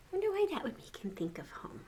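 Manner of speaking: ordinary delivery, nothing marked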